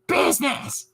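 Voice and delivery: high squealed cat voice; squeaky cat. Retold or imitated. Comedian's cats always doing business, squeaky. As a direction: squeal the word like an excited cartoon cat. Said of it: "he does voices".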